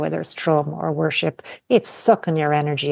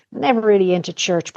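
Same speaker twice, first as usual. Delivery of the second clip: normal talking voice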